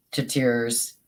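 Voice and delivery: normal talking voice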